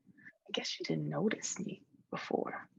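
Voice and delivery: soft, hushed whisper. Acted. Imaginary friend confessing she went unnoticed, whispered. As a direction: confide it almost to yourself.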